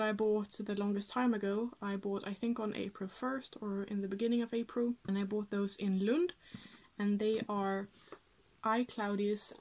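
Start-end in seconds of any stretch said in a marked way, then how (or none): none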